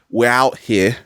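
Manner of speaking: ordinary delivery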